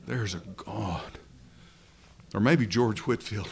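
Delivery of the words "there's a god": a hint of Missus Dodson, quieter and slow; lightly voiced old church lady, hushed and sure. Preacher quoting Missus Dodson's quiet conviction. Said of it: "I wish I could say that like Missus Dodson".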